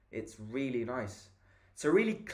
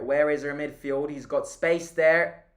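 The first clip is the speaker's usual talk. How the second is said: frustrated shout; 7 dB louder than usual. Real, not acted. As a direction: call it out at the screen in frustration. Frustrated at open player.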